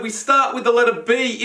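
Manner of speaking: ordinary delivery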